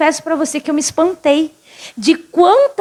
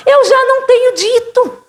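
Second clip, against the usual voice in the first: high-pitched, insistent reproach; acted exasperation; 10 semitones higher than usual. Jesus reminds Martha of his promise, acted exasperation. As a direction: reproach like a parent who keeps repeating.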